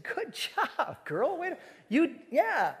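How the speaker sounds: put-on high, laughing "good job, girl"; laughing praise. Pastor praising teen girl, laughing high and bright. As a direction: cheer her on through a laugh.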